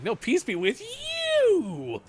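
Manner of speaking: very high, very slow squeal; mock insistent greeting. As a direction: stretch it out high like a playful insistence. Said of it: "I'll be like".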